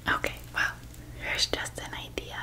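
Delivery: ordinary talk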